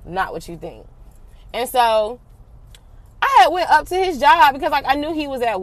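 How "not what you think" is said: soft pleading. Retold or imitated. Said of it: "like, sis".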